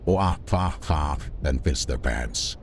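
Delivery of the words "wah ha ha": mock laughter. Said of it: "they would be like".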